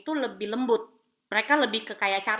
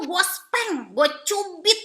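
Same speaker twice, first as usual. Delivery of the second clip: loud, raised imitation of a punishing parent; mock threat; 7 semitones higher, 5 dB louder, much slower than usual. Parent threatening punishment to a child, mock stern. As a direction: threaten it loudly, hammed-up.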